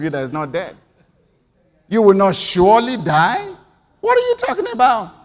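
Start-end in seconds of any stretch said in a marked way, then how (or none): 1.9-5.1 high, loud, incredulous cry; outraged disbelief. Real, not acted. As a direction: shoot up in shocked disbelief.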